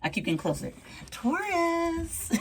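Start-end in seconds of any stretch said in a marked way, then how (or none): 1.1-2.3 flirty call. Pretend. Acts an admirer calling Taurus.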